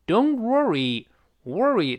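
slow American r in "worry"; "Don't worry" with the American "wor" sound. Shown as a demonstration. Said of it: "鲜明的美音的特色".

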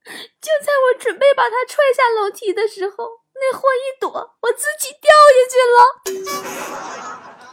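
hammed-up, very high sobbing voice; choked with tears. Aunt tearfully explains her fall downstairs, choked and squealing. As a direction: wail it out in mock sobs, swelling loud near the end. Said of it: "哽咽着说".

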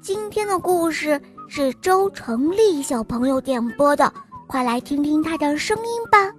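usual speaking voice

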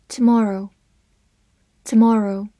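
tomorrow said slowly the American way. Shown as a demonstration. Teacher reviewing the word tomorrow, American pronunciation. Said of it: "美式发音tomorrow".

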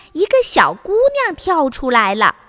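ordinary delivery, nothing marked

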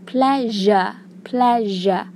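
"pleasure" said clearly with the zh sound, as a teacher's model; careful pronunciation. Shown as a demonstration. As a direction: model "pleasure" slowly for the class. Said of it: "注意这个发音哈".

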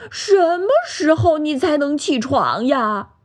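high, impatient storybook complaint; displeased. A narrator's reading. Pig fed up with sleeping bear, high displeased whine. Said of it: "小猪不高兴地说".

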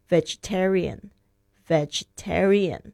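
vegetarian said slowly, stress on the "ta" syllable; slow teacher demo of vegetarian, stress on "ta". Shown as a demonstration. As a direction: say vegetarian twice, leaning on "ta". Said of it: "注意一下那个重音是在T上面啊".

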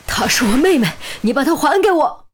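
high, desperate yell; struggling cry. A narrator's reading. Brother struggles toward his sister's captor, high yelling. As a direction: cry out high while fighting to break free. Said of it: "严峰一边挣扎一边高声叫喊".

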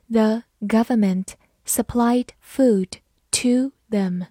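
slow, careful word-by-word reading. Shown as a demonstration. Teacher reading the second sentence slowly for students. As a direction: read it slowly so students can follow. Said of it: "第二句我们也慢读一遍。".

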